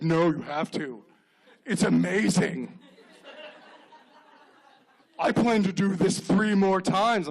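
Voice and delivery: slow, mock-fervent insistence; insistent parent. Exhausted parent urging a friend to have kids, mock-fervent. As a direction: stretch it out like a hammed-up convert to parenthood.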